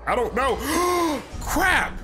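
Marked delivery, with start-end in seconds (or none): 0.6-1.4 sharp frightened gasp. Real, not acted. Scared by a jump scare. 1.4-2.0 startled curse. Real, not acted. Caught by the monster, a high startled cry.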